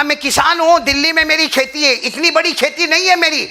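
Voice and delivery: high, loud impassioned preaching; preaching at full force. Acted. Preacher telling the congregation the story, in loud impassioned preaching. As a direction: call it out to the crowd with fervour.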